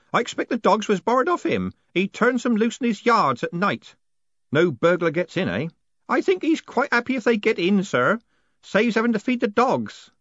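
put-on high-pitched old postman; old postman Groat.

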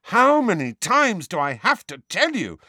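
high, loud exasperation; temper rising. A narrator's reading. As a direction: raise the voice in exasperation. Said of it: "temper and voice both rising".

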